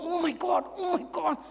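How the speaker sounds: high, hushed mock panic; fretting under the breath in pretend fright; imitating scared response. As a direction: play a frightened would-be entrepreneur. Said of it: "this is the kind of response".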